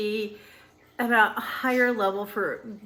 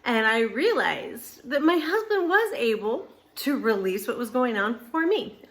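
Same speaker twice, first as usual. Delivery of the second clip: normal talking voice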